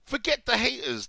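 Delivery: high, loud mocking sing-song; hammed-up mockery of "Forget the haters"; mocking headline. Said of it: "where I'm being told that I'm offended".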